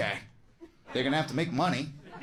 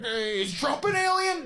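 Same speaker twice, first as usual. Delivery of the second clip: frantic CNN; 9 semitones higher than usual. Retold or imitated. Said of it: "CNN losing their mind".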